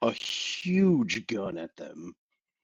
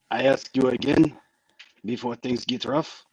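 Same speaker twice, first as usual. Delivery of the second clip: insistent, badly faked French accent; 3 dB louder than usual. Felix warns her before things get rough, bad French accent. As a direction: press the question in a hammy French accent. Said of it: "in a very bad French accent".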